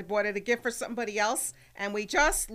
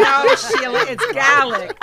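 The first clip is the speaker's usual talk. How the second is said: laughing; 4 semitones higher, 14 dB louder, slower than usual. Real, not acted. Host laughing correcting caller.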